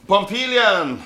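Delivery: loud, high call across the room. Acted. Editor calls his assistant Pompilian across the newsroom, loud high call. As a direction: yell for the assistant.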